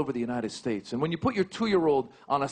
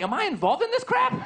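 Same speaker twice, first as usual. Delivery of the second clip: 1 mock panic; 3 dB louder than usual. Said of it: "I'm like".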